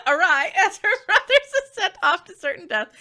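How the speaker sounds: high, loud, breaking up with laughter; laughing through words. Real, not acted. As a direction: crack up at your own slip while talking. Laughing at own mispronunciation.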